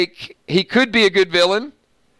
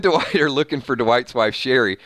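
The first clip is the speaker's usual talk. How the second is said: normal talking voice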